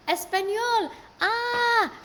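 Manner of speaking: high, loud cry of delight; excited exclamation. Real, not acted. Host reacts to Spanish on livestream, excited exclamation.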